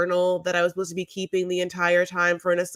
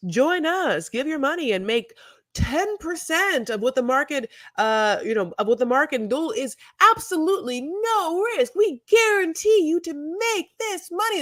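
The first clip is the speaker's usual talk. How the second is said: salesman pitch; 8 semitones higher than usual. Retold or imitated. Accountants' pamphlet pitch voice.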